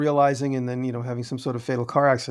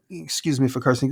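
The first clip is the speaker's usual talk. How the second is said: ordinary talk, nothing marked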